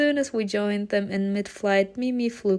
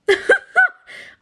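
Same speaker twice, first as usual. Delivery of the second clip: high laugh; 8 dB louder than usual. Real, not acted. Reacting to teacher's answer.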